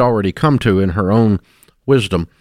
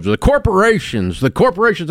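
higher-pitched repeated mock grievance; mimicked relative; 7 semitones higher than usual. Host imitates relative blaming corporations, high mock griping. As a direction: parody a relative's pet complaint. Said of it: "I got a relative".

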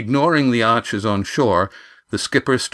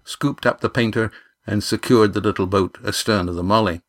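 ordinary delivery, nothing marked

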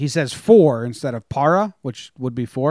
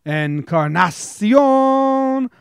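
much higher, loud announcer call; home run call; 7 semitones higher, 4 dB louder, slower than usual. Retold or imitated. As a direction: belt it out like a broadcaster. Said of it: "My top was".

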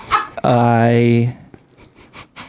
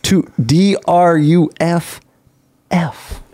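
spelling it out; much slower than usual. Shown as a demonstration. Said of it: "spelling bee answer".

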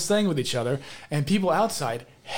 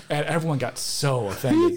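ordinary talk

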